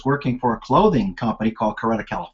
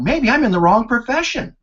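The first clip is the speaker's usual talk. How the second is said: bright realisation; 10 semitones higher than usual. Retold or imitated. Retold realisation.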